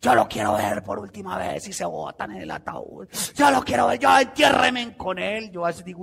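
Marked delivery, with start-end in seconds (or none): 0.0-1.7 a hint of a hypocrite wailing low; lightly mock the fake grief; mock mourner. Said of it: "hipócritas rasgándose las vestiduras". 3.3-5.5 high, loud hammed-up wail; mock wailing mourner. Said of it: "hipócritas rasgándose las vestiduras".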